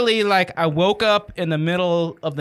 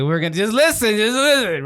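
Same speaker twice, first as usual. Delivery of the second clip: put-on higher, insistent drunk; imitated drunken insistence; 3 semitones higher than usual. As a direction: play yourself plastered, pleading to be heard. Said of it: "that's my drunk voice".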